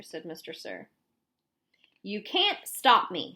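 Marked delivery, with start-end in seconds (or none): 2.0-3.3 loud, slow defiant snap; snapped. A narrator's reading. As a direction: bite back hard at the man blocking her. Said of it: "she snapped".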